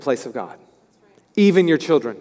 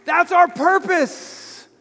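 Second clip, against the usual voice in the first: excited agreement; 12 semitones higher, much slower than usual. Retold or imitated. Preacher cheering Arnie in the board meeting, excited. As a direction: cheer him on, fired up. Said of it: "And I was like".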